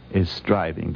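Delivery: ordinary talk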